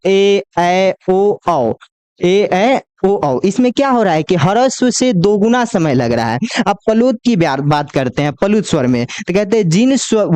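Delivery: ordinary talk, nothing marked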